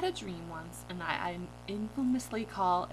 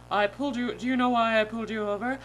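lightly voiced officer, louder; police officer; 5 dB louder, faster than usual. Officer questioning a pulled-over driver, lightly voiced authority. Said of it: "The officer goes".

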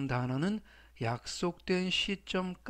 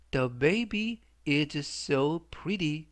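very slow, every word spelled out; slow teacher's model sentence; slow model reading; much slower than usual. Shown as a demonstration. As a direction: read it slowly for learners to copy. Teacher reads example sentence slowly.

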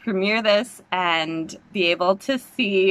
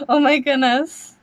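higher, rising thrilled gasp of words; excited; 5 semitones higher than usual. Real, not acted. Reacting live to trailer.

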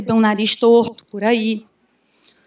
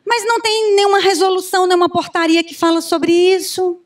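higher, whiny objector voice; mock naysayer complaint; 6 semitones higher than usual. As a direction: imitate the nitpicker who always objects. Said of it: "cri-cri que diz assim".

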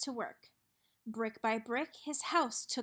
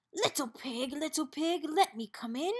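lightly voiced sly wolf calling; a hint of a wolf coaxing; 3 semitones higher than usual. A narrator's reading. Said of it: "said the wolf".

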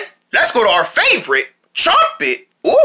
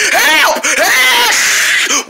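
playful cry for help; 10 semitones higher than usual. Acted. Logo chasing the speaker.

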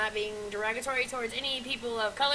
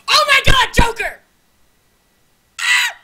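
loud, high startled cry; Joker-face horror scream; shocked shout; 11 semitones higher, 16 dB louder than usual. Real, not acted. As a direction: yelp in shock. Shocked by own appearance.

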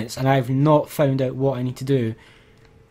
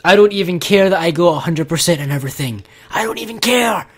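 louder, rising to a high angry yell; raging; 3 semitones higher, 4 dB louder than usual. Real, not acted. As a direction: let it build into a furious outburst. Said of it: "I'm too angry".

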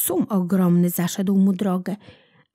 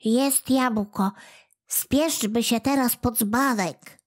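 little creature urging; 3 semitones higher than usual. A narrator's reading. Kłobuk urges the cat.

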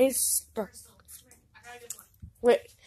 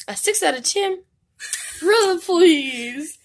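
scoffing; 9 semitones higher, 7 dB louder than usual. Real, not acted. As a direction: drag it out in disbelief. Scoffing at the rating.

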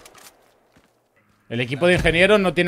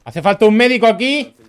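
raised, urgent yell over gunfire; shouting orders over battle; 5 semitones higher than usual. Real, not acted. Calling for medic under fire.

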